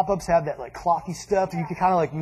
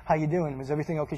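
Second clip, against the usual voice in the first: softer, lower, careful greeting; cautious dad; 5 semitones lower, 8 dB quieter, faster than usual. Retold or imitated. Dad approaching angry mom for all of them, cautious and soft. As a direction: tiptoe gently into the question. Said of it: "he bit the bullet for all of us".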